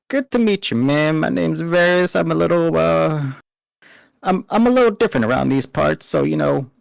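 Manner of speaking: put-on high-pitched, forced Southern accent; a Southern accent that keeps slipping, pitched high; failed southern accent. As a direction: reach for a Southern accent that just doesn't work. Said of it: "tries to talk in a southern accent".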